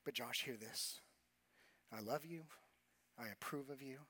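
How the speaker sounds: soft, low and tender, as a father to his son; tender voice of God. Acted. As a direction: speak it gently, like a loving father. God's words spoken to him.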